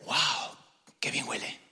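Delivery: breathy whisper of delight; whispered admiration. Pretend. Passerby whispering admiration at a pleasant scent. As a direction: whisper it like catching a lovely scent.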